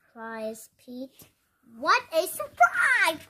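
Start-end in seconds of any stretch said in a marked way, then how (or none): 1.8-3.2 excited exclamation. Acted. Pete cries in surprise.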